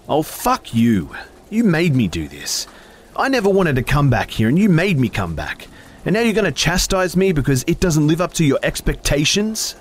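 high, loud furious shout. A narrator's reading. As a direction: scream the blame at her. Furious quarrel.

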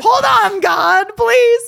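high, loud call up to heaven; mock pleading. Asking God to hold off, loud mock plea. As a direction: playfully beg at the top of your voice.

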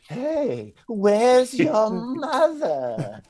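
high, slow, drawn-out eerie question; creepy man voice. Retold or imitated. Podcast kid imitates the strange man, high and slow. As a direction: lean in like a creep.

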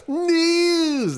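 high, slow, crying complaint; hammed-up whiny lament; mock wail. Mock wail over the news sounder.

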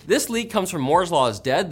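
ordinary delivery

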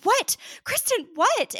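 high-pitched, urgent questioning; mock alarmed demand. Retelling Lauren's reply to a hysterical caller, alarmed. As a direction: imitate someone urgently demanding to know what's wrong. Woken sister demands what happened.

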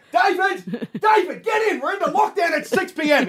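high shouted call across the yard; mum yelling. Retold or imitated. Mum calling dad in from the mowing. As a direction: yell for him from the back door. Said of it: "Mum's run out and said".

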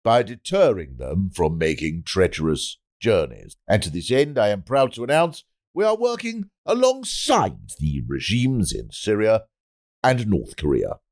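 measured oratory with weighty pauses; pompous politician's speech. Acted. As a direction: declaim it like a minister addressing the nation. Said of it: "politicians make those speeches that go".

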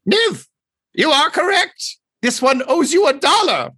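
very high, loud bird-creature call; yelling over shoulder. Acted. Bird doctor yells to his colleague, high loud voice. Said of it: "he turns and yells over his shoulder".

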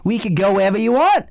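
hammed-up Aussie accent; exaggerated Australian bloke. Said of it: "weird accent you're doing".

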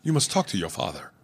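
mock failed African accent, low and soft; an African accent that isn't even trying; low, underplayed send-up of a bad African accent; Arsenio Hall. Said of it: "Arsenio didn't even try".

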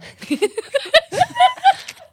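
loud high-pitched burst of laughter. Real, not acted. Laugh after own ghost line.

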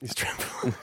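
hushed aside, breath only; whispered. Real, not acted. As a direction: whisper the verdict, voice dropped away. Repeats verdict in whisper.

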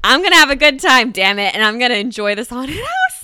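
loud, emphatic self pep talk; forced determination. Retold or imitated. Psyching herself up in the haunted house, loudly determined. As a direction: belt it out, breaking into a laugh at the end.

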